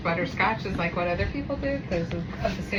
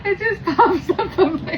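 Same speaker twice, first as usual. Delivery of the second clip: laughing; 12 semitones higher, 7 dB louder, slower than usual. Real, not acted. Mom laughing while explaining.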